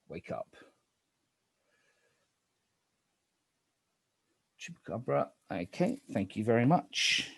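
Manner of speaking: low, hushed night-phase calls; spooky game narrator. Acted.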